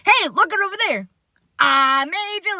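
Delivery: playful high taunt, the last words slowed; spring the old made-you-look prank on a friend; teasing trick. Retold or imitated. Playground prank on a friend, high playful taunt. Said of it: "it reminds me of".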